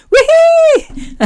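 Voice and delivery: high squealing wee-hee; gleeful whoop. Retold or imitated. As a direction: whoop like a kid on a bike. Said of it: "you're just going".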